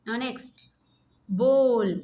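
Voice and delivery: ordinary delivery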